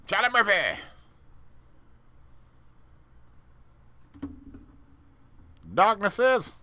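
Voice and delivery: hammed-up sketch callout; Chappelle sketch voice. Quoting a Chappelle sketch, high and loud. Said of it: "David Chappelle".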